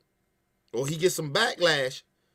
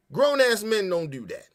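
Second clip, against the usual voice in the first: usual speaking voice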